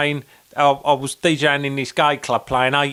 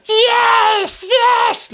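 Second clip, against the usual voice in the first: high, loud victory shout; triumphant yell; 5 dB louder than usual. Retold or imitated. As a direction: cheer like winning the World Cup. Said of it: "like that".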